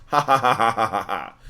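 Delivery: just say the ha's, no villain flair; plain ha ha. Retold or imitated. Streamer contrasting chat's laugh with Mandark's, flat ha-ha. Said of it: "it wasn't one of those".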